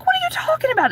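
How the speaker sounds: very high, incredulous question; shocked disbelief. Retold or imitated. Retelling her shocked reply.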